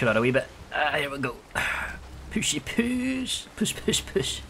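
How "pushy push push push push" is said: high, singsong pushing chant; playful straining. Pretend. Player shoves his boat out, high playful effort. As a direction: coax it along in a squeezed high voice.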